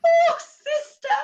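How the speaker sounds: high, loud crying wail. Acted. Mother crying out to her sister over the scandal. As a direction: cry out to her sister in despair.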